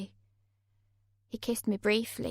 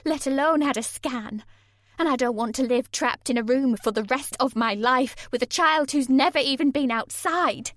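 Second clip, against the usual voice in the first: higher, louder desperate tirade; furious outburst; 4 semitones higher, 7 dB louder than usual. A narrator's reading. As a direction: pour it all out at him, frightened and furious.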